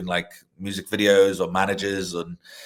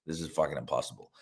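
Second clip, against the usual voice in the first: lower, much softer and fast, under the breath; muttered disbelief; 7 semitones lower, 10 dB quieter, faster than usual. Retold or imitated. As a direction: grumble it quickly to yourself. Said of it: "I was like".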